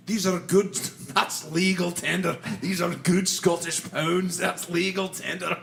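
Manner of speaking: lightly voiced Scottish accent, jokey; a playful Scottish banker bit. Co-host joking about Scottish pounds in a light Scottish accent. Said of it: "You have the best Scottish accent".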